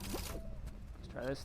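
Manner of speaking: slow quiet focused murmur; slow soft drawl. Real, not acted. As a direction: stretch "Let's try this" out slowly under the breath. Retrying in game, drawn out.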